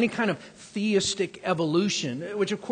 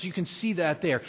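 usual speaking voice

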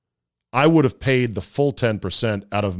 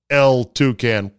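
ordinary talk, nothing marked

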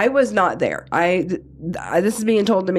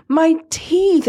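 imitating sobbing husband; 9 semitones higher than usual. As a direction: mimic a man crying too hard to speak. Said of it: "And he's crying".

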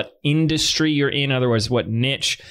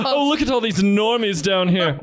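higher, mock-posh sneer at the commoners; snooty wine snob; 6 semitones higher than usual. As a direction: look down your nose and sneer. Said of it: "my impression for you".

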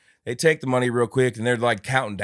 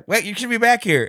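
high, louder silly guard; mock guard voice; 12 semitones higher, 6 dB louder than usual. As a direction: play the clueless guard. Imitating guard again.

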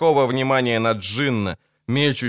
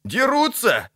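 high-pitched cry of alarm; onlooker's high excited yell; shouted; 12 semitones higher, slower than usual. A narrator's reading. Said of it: "крикнул один из глазеющих бродяг".

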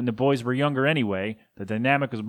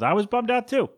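high, whiny mock disappointment; mock bummed; 10 semitones higher than usual. Host joking about no Belmonts, pitched way up. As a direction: squeal it like a let-down kid. Mock dramatic then plain repeat.

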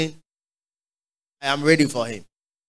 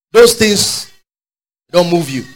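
ordinary delivery

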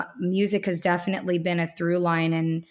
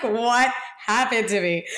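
amused, half-laughing question; laughing at herself; 4 semitones higher than usual. Retold or imitated. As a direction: poke fun at her own forgetfulness. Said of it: "I'm like".

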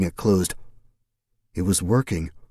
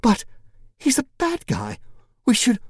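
much higher, urgent objection; high protest. A narrator's reading. Feline objecting to Jim, high and cracking.

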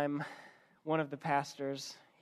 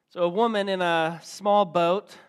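ordinary delivery

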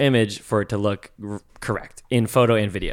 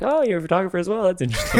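higher-pitched, quick mock-casual interest; feigned nonchalance; 9 semitones higher, faster than usual. Fan hiding excitement from his photographer idol, feigned nonchalance. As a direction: play it off as if barely impressed. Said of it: "I'm trying to play it off".

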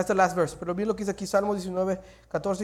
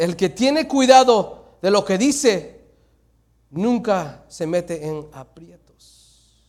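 slow scripture reading; 2 semitones lower, 6 dB louder, much slower than usual. Acted. Preacher reads the proverb slowly aloud to the church. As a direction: read it slowly and loud, weighing each word.

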